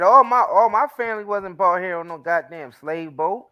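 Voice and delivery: normal talking voice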